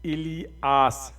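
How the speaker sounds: الیاس drawn out slowly as "ilias", i and a kept apart; another popular variant. Shown as a demonstration. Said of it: "عوام مختلف طریقے سے لکھتی ہے اور کہتی ہے". (الیاس کو بہت آہستہ "اِلی اَس" کھینچ کر)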